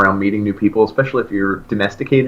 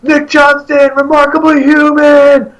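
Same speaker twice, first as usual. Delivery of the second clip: high, loud fan yell, slowed and stretched; excited shout; 6 dB louder, slower than usual. Retold or imitated. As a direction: call out the album to the band. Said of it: "So I went".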